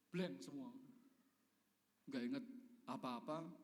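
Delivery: normal talking voice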